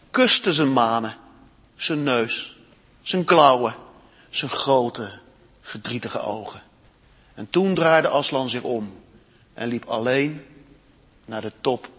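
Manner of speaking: expressive read-aloud storytelling; story reading. A narrator's reading. Storyteller reading Narnia to children in church, expressive.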